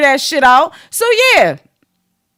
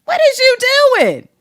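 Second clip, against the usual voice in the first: sassy retort; 8 semitones higher than usual. Retold or imitated. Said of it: "you be like".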